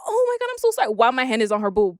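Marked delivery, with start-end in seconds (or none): 0.1-0.9 high-pitched gushing sorry, hammed up; high mock apology. Apologizing to Cam with hand still there, in a high hammed-up voice. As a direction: squeal a fake-sorry apology. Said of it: "I'm like".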